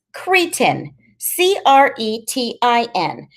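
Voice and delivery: high-voiced kid spelling it out slowly, then defining; Freak reciting a definition. A narrator's reading. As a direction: pitch it high like a know-it-all reciting. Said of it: "Freak says".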